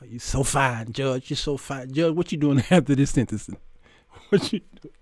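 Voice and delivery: low, soft mock come-on; camp flirting. Defendant hitting on the judge, low and flirtatious. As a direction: coo it quietly, imitating a flirt.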